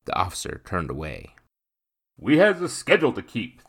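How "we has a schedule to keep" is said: high, loud rough bellow; bellowing coachman. A narrator's reading. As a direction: bellow it from the box. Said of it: "the coachman bellowed".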